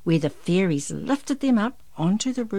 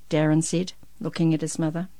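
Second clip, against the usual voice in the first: ordinary delivery, nothing marked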